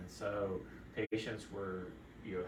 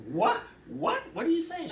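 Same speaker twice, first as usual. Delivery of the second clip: high, louder baffled "what? what?"; doctor baffled by patient's claim, high louder disbelief; incredulous; 9 dB louder than usual. Retold or imitated. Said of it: "I'm like".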